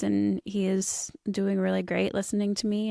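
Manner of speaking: ordinary talk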